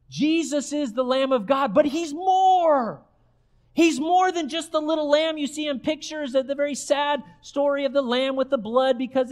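impassioned pulpit declaration; emphatic preaching. Real, not acted. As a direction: preach it hard, stretching the key word. Preaches at full force.

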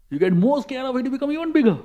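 high-pitched, building repeat; heightened warning that climbs in pitch; rising escalation. Shown as a demonstration. Repeat builds to show fear growing.

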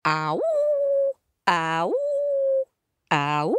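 hound's ah-woo; dog howl. Acted. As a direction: let each howl glide up. Howls along with the listeners.

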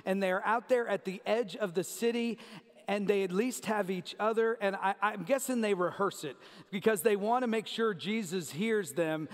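normal talking voice

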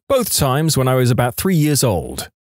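usual speaking voice

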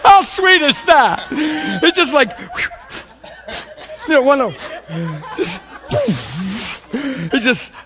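high, loud, laughing shout of delight; laughing exclamation. Real, not acted. Overjoyed laughing outburst.

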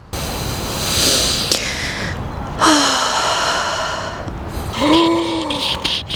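long, high gasp; startled gasp. Real, not acted. Vlogger realises a magnet is lost, startled gasp. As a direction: suck in air in alarm.

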